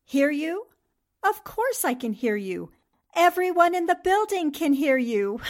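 pointedly loud tease; teasing retort. Acted. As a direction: call it out so the whole office hears.

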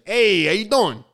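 put-on high New York-accented greeting; playful mock New York accent; Big Joey greeting. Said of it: "she's like".

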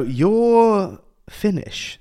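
a waiter teasing in a slow high voice; slow mock restaurant worker. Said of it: "And they'd go".

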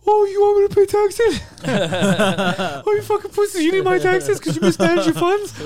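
high, loud jeering taunt; shrill-free high loud schoolyard mockery. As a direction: taunt the government like a bully. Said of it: "I gotta do it to the government".

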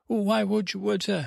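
high, incredulous acted parent; baffled questioning. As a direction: sputter it high, lost for an explanation.

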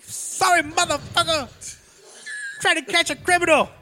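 very high mock cop voice; tough cop voice. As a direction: sneer it like a hammy cop. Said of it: "It's like".

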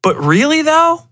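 rising into a high doubtful squeak; openly doubt his story; skeptical. Retold or imitated. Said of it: "I was like".

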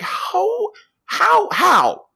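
very high-pitched, rising-in-volume disbelief; incredulous. Real, not acted. Teller questioning the story's hazmat-suit scene, incredulous.

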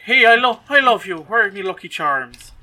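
loud, high hammed-up Irish; big cartoon Irish brogue; Irish accent. Bowler hat man professing love, in a mock Irish accent. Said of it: "'Cause he's Irish".

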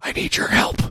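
whispered conspiratorially, as if leaning in close. Retold or imitated. Friend leaning over to Ben, whispered request. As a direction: hush it like a secret between friends.

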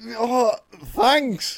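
high, loud mock-awkward thanks; mocking friend's reaction. Friend teasing how he reacts to fans, high mock thanks. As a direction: squeak it out like a flustered celeb. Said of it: "because of your reaction".

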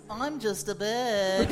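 put-on slow, goofy drunk bird; pelican voice. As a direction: play it dumb and innocent, slowly. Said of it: "Did that pelican just talk?".

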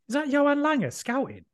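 high, startled mock question; mock surprise. Teasing George about a scout, high mock surprise. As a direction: pretend to spot the scout, amazed. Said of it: "you were like".

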